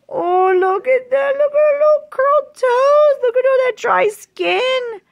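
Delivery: very high squealed cooing; delighted cooing. Real, not acted. Vlogger cooing over the doll's curled toes, delighted.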